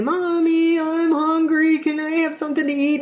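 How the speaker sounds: put-on high little boy's plea, mommy I'm hungry; lightly voiced small boy asking his mom for food; child's voice. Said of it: "and he'd say".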